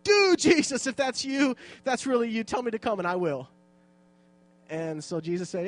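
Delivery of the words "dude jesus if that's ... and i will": high, excited dude-style outburst; acted impulsive excitement, easing as it goes; impetuous blurting. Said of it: "he spoke without thinking".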